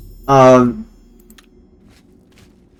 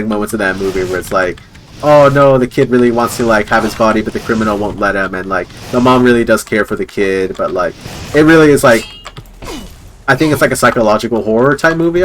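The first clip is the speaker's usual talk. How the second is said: ordinary delivery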